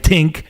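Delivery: "tink" said with a hard t, no th; "tink", the dropped-th Montreal way; tink for think. Shown as a demonstration. Immigrant talking about radio days, saying "tink" without th. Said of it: "I mean words like".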